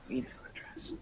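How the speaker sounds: "Email address" whispered, no voice; whispered model. Shown as a demonstration. Teacher whispering "Email address" as a prompt. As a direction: breathe "Email address" to yourself. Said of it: "we wanna emphasize the second syllable".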